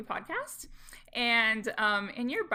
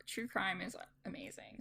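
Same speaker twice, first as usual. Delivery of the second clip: ordinary talk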